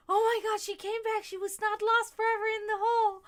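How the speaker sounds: high, loud overjoyed kitten voice; cat's relieved thoughts. Retold or imitated. The cat seeing her return, high loud overjoyed. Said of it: "That's his fucking thought".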